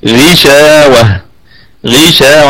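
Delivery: very slow غشاوة with a lightly heavy غ; tajweed demo. Shown as a demonstration. Teacher models غشاوة slowly for students. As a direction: draw out غشاوة, the غ only slightly heavy. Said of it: "في أقل درجات التفخيم".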